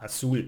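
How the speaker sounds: "azul" with the weight on ZUL; last-syllable stress. Shown as a demonstration. Teacher applying the rule, "azul" stressed on ZUL. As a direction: lean into the second syllable of "azul". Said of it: "we need to emphasize the last one".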